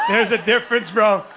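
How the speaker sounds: loud, laughing insistence; laughing shout. Real, not acted.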